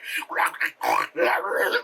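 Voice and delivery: mock fried metal scream; guttural deathcore roar; deathcore growl. Shown as a demonstration. Telling the singer to go full deathcore, mock growl. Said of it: "just go deathcore".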